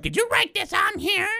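high-pitched mock mom voice; playful imitation of an irritated mom; mom's suspicious question. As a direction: squeak it out like a suspicious mother. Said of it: "she's like".

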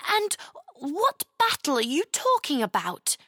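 put-on high, startled little girl voice; bewildered girl. Chloe questioning the strange knight, high and bewildered. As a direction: ask it wide-eyed and baffled. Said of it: "her mouth hung open in disbelief".

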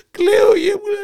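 mock crying shout. Grandpa cries out.

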